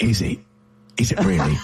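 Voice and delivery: put-on low English-accented action-star voice; deep, terse English accent impression; Jason Statham. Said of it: "That's Jason Statham".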